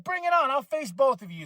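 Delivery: high, cocky dare thrown back; mock tough challenge. Dom taunting both rivals, high cocky dare. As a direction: puff up and taunt them both. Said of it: "And Dom's like".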